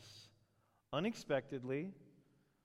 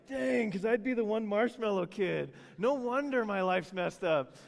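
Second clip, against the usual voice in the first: higher, louder mock dismay; dismayed listener; 7 semitones higher, 7 dB louder than usual. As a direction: play the one-marshmallow kid realizing it. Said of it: "I know some of you are like".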